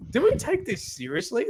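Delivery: incredulous. Pretend. Podcaster mock-asking fans about canon, incredulous. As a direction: pitch rising high in disbelief. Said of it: "it's sort of like".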